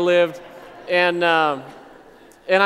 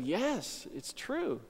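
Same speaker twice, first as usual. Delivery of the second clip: much softer, tender yes; gentle reassurance; 3 semitones higher, 13 dB quieter, much slower than usual. Real, not acted. Pastor assures the stranger it is true, soft and tender. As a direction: reassure him softly and sincerely.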